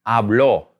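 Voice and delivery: habló with the last syllable stressed; stress on final O. Shown as a demonstration. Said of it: "when we say it with that accent".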